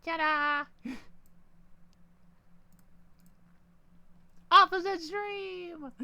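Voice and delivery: high, slow, showy reveal; triumphant ta-da. Real, not acted. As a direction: announce it with a flourish. Announcing the upside-down stream.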